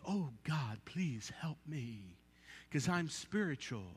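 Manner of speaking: low, hushed, slow holy plea; mock pious prayer. Preacher mocking himself as super-spiritual, mock pious and hushed. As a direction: put on a soft, saintly prayer voice.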